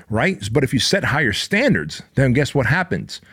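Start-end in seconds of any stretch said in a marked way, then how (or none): none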